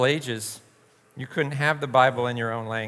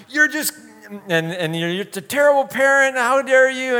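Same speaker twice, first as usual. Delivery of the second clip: high, louder mock telling-off; scolding parent; 11 semitones higher, 6 dB louder than usual. A parent scolds the neighbor for the kid's swearing, high mock outrage. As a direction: pretend to chew out a neighbor parent. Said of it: "and be like".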